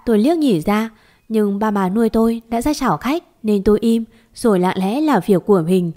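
ordinary delivery, nothing marked

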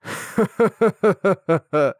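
villainous high cackle; sinister laugh. Acted. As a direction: laugh like a gloating demon. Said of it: "Вновь послышался зловещий смех".

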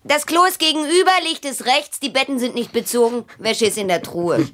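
fast, high and brisk; quick, high, defiant listing; rattled off. Acted. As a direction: rattle it off like a hotel clerk. Rattling off house rules defiantly.

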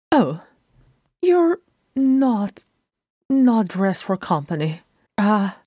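high, halting embarrassment; flustered stutter. A narrator's reading. Bilbo startled by Thorin's undress, high flustered stutter. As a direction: stammer it out, caught off guard. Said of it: "Bilbo's voice stuttered".

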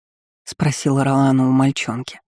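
ordinary delivery, nothing marked

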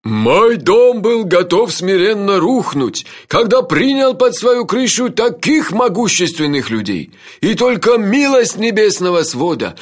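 well-trained, declamatory shout; baritone bellow. A narrator's reading. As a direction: boom it out slowly like a lordly host. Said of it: "хорошо поставленным баритоном заорал хозяин дома".

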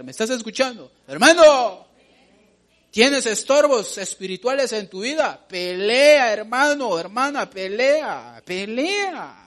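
high, loud fervent sermon cries; rally the congregation to fight; fiery preaching. Acted.

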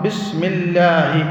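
الله said with a thin, light lam after the kasra of بسم; بسم الله, the lam of الله kept light, not heavy. Shown as a demonstration. Teacher showing the students الله with a thin, light lam. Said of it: "نقول الله لما؟ لأنها مرققة".

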